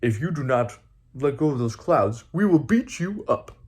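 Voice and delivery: a mild mock threat to the giant; put-on tough voice. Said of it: "they put their voices down a little bit".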